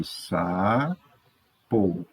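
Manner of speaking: SAPO pulled apart slowly, saa, po; syllable by syllable. Shown as a demonstration. Teacher writing sapo in two boxes, syllable by syllable. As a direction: say sapo slowly with a pause after sa. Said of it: "duas sílabas".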